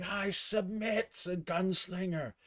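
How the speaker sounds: a bloodied man forcing out his surrender; high choked submission; screamed surrender. Acted. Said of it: "as he's screaming".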